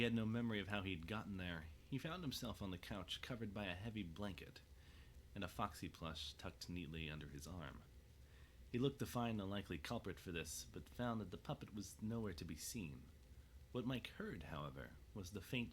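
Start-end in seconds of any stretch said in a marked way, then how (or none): none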